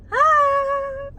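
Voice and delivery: high squeak of embarrassment; squeal, caught off guard; sudden high-pitched yelp; embarrassed squeal. Real, not acted.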